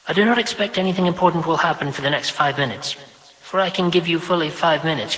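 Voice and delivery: busy mother, briskly obliging. Acted. Vashti speaking to her son.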